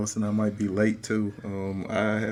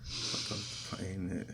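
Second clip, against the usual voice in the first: slow recital; 9 dB quieter, slower than usual. Retold or imitated. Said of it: "this is so terrible without rhythm".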